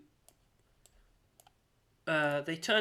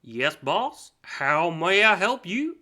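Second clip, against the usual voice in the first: eager servant; 4 semitones higher, 9 dB louder, slower than usual. Acted. Servant answers the Archfey.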